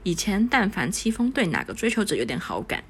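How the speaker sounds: plain reading voice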